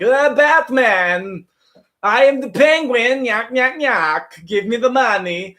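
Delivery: loud, high, hammed-up Russian villain; drawn-out cartoon Russian accent, taunting the hero; Penguin, Russian accent. Russian Penguin confronting Batman in an imagined movie, hammed-up accent. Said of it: "a Russian Penguin and he's like".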